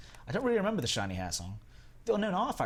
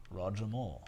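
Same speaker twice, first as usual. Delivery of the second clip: Roger Moore impression; 2 semitones lower, 4 dB quieter than usual. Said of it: "I'm working on my Roger Moore impression".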